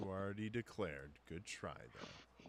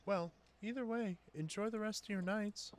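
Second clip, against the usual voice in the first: cheery sign-off voice; 6 dB louder than usual. Acted. GM sign-off in character.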